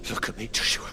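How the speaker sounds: breath-broken plea, a little stronger; anxious plea. Acted. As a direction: urge your brother to respond. Pleading with unresponsive brother.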